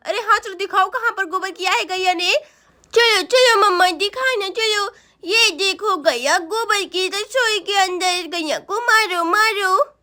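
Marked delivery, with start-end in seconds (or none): 2.9-9.9 put-on childish, pleading voice; insistent little boy. Boy begs mamma to come and see, childishly insistent. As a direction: urge her along like a small child.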